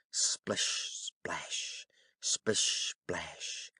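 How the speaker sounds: splish splash breathed very softly; whispered splashing. A narrator's reading. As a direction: hush the wet footsteps. Frog's wet steps on stairs.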